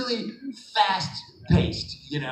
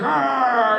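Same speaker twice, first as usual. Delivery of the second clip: mock robot roar; 8 semitones higher, 6 dB louder than usual. Actors roaring at a mop.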